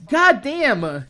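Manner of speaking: ordinary delivery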